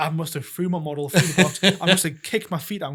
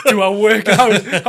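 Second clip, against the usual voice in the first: slow, loud, higher question through laughter; slow, much louder incredulous laugh; laughing disbelief; 5 semitones higher, 13 dB louder, much slower than usual. Retold or imitated. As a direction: laugh at the very idea. Retold laughing at nurse's question.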